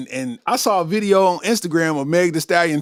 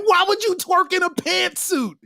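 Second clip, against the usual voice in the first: mock exasperated shout; 10 semitones higher, slower than usual. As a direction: cry out in mock disbelief. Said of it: "I'm like".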